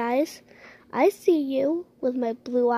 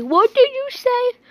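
sharp, suspicious question, higher and louder; suspicious demand; 8 semitones higher, 5 dB louder than usual. Pretend. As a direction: catch the pet plotting, demand it. Suspicious owner catches the plotting.

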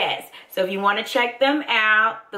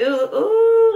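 loud, high ooh ooh; excited squeal; 13 semitones higher, 6 dB louder than usual. Real, not acted. Spotting the little applicator, a loud high squeal. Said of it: "That's how excited I am".

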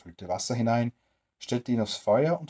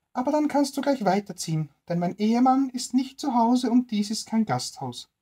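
put-on high, louder old woman voice sending him off; grumpy old woman; 12 semitones higher, 5 dB louder than usual. Stingy old woman refusing the tramp a bed, high and loud.

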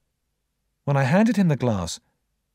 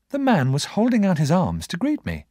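usual speaking voice

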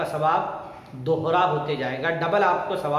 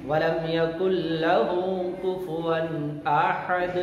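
slow, melodic tajweed chant; measured, sustained recitation on a steady note; Quran recitation; much slower than usual. Shown as a demonstration. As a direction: chant it with long, drawn-out vowels. Quran recitation, third time through.

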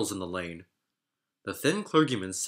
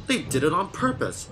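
higher, indignant cross snort; mock-cross engine, huffing the accusation; snorting crossly; 7 semitones higher than usual. A narrator's reading. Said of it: "he snorted crossly".

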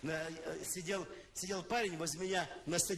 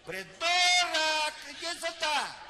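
very loud, drawn-out Odessa shout; Odessa intonation; 10 semitones higher, 11 dB louder, much slower than usual. Retold or imitated. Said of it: "точную одесскую интонацию".

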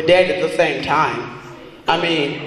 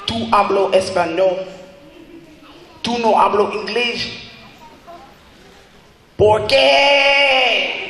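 mock Spanish speaker; 8 semitones higher, slower than usual.

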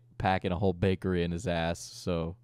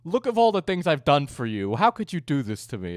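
raised, pleading mock outrage; Keem guilt-tripping; 5 semitones higher, 4 dB louder than usual. Mimicking Keem's complaint over favours done, mock aggrieved. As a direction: play up a wounded guilt trip. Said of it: "He's like".

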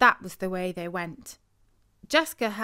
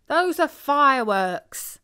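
slow, louder, higher insistence; exasperated correction; 6 semitones higher, 7 dB louder than usual. A narrator's reading. Uncle corrects the spooked children, slow and louder. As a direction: set them straight.

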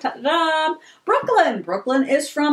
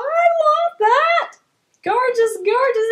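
thrilled; 8 semitones higher than usual. Real, not acted. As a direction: gush over the present. Thrilled by a gift.

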